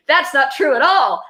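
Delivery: indignant protest. Real, not acted. Protesting the book's claim.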